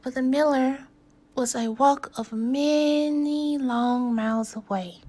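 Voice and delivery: ordinary delivery, nothing marked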